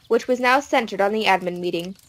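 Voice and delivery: plain reading voice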